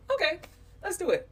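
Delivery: high-pitched, chirped agreement; agreeable. Retold or imitated. Woman acting out her reply once reassured, agreeable. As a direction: give in readily, voice lifted high. Acts being won over instantly.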